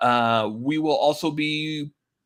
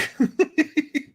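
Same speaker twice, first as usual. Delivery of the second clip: short high burst of laughter. Real, not acted. Laughs at own joke.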